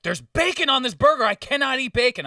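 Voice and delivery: high, loud whiny complaint; mock the customer with a high whine. Server imitating a picky customer, high whiny complaint.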